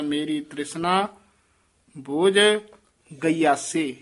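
pauses around ਬੋਝ, then ਗਯਾਸੇ as one word; joined ਗਯਾਸੇ. Shown as a demonstration. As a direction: set ਬੋਝ apart and run ਗਯਾਸੇ together. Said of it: "ਇਕੱਠਾ ਹੀ ਕਰਾਇਆ ਜਾਂਦਾ ਹੈ".

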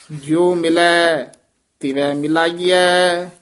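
drawn-out chant with held endings; scripture recitation. Shown as a demonstration. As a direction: recite it fully and steadily. Recites before pause demo. (ਹੇਕ ਨਾਲ ਪਾਠ)

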